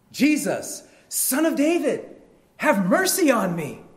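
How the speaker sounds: high, loud plea; crying out. Acted. Blind man calls to passing Jesus, crying out. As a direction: shout it out to be found. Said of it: "And he cries out".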